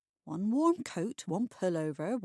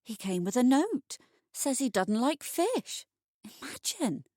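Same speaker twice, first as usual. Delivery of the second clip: higher, louder disbelief; can you believe it; incredulous gossip; 5 semitones higher, 5 dB louder than usual. Acted. Peggy telling Minnie about the note, higher and incredulous.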